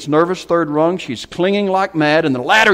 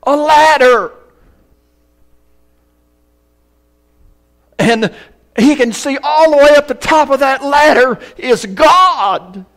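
high, loud, drawn-out preacher's delivery; preaching at full force; 11 semitones higher, 5 dB louder, slower than usual. Acted. Preacher telling Jacob's story, preaching at full force. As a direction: thunder the story to the congregation.

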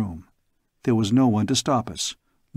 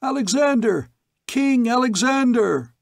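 much higher, louder chant of acclaim; crowd tumult; 12 semitones higher, 4 dB louder than usual. Acted. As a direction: roar his name like a crowd. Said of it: "A tumult interrupted him".

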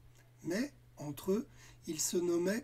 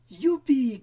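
high, loud shout of delight; joyful cheer; 8 semitones higher, 12 dB louder than usual. Acted. Children cheering at helping Cole, high and loud. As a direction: cheer with joy. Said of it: "crièrent les enfants".

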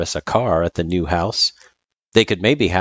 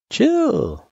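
laid-back guy voice. Acted. Sans tells the kid to relax.

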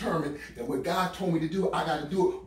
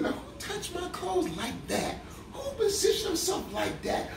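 much higher, laughing mock question; Jesus asking, amazed; 13 semitones higher than usual. Preacher voices Jesus asking who touched him, high and laughing. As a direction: ask it with delighted surprise.

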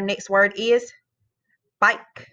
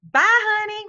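high, lilting "Bye, honey" sign-off; sing-song bye honey; 11 semitones higher than usual. Real, not acted. Teacher singing goodbye to her class, high and playful. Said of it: "I wanna tell y'all, bye, honey".

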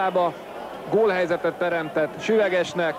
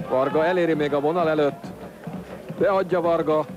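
usual speaking voice

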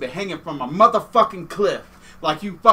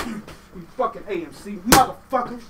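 normal talking voice

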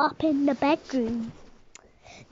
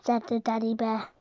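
ordinary delivery

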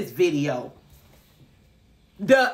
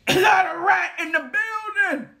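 crying shout; 9 semitones higher than usual. Retold or imitated. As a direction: wail it out. Outraged at the rat.

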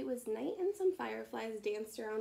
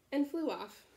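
ordinary delivery, nothing marked

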